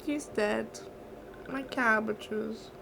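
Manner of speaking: slow, soft, choked-up mourning; grieving quietly, close to tears; real tearful grief. Real, not acted. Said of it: "that scene is always when it makes me cry".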